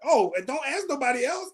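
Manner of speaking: lightly imitating a mean comic brushing someone off; mocking dismissive comic. Mean comic dismissing the audience, a hint of snide.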